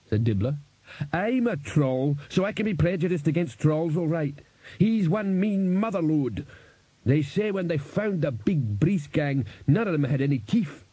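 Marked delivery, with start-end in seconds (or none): 1.1-10.8 a big troll, gruff and knowing, pitched up; troll Cliff. A narrator's reading.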